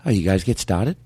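"You guys get started" in Boston; Boston accent. Shown as a demonstration. Student copying the Boston phrase. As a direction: copy it Boston-style. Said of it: "practice our Boston accent".

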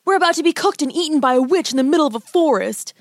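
put-on high, frantic young boy; panicked boy voice. A narrator's reading. Boy panicking about witch.

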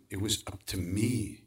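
normal talking voice

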